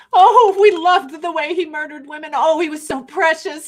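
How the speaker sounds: high, loud gushing parody; mock mourning. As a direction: gush sarcastically like an adoring mourner. Mocking mourners of the leader.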